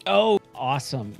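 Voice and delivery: ordinary talk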